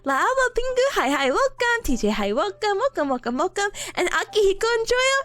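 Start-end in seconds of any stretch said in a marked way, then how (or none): none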